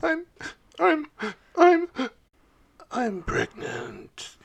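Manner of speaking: stammering, then a slow dropped reveal; halting confession. Acted. [name] confessing to the guys, halting stammer. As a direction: stall on each I'm before the reveal. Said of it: "And she goes".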